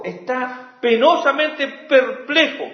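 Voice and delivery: ordinary delivery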